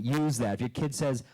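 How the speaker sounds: ordinary delivery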